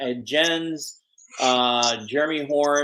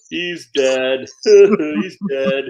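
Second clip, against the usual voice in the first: gleeful teasing, breaking into laughs; laughing mockery of a doomed pal; imitating laughing friend; 8 dB louder, much slower than usual.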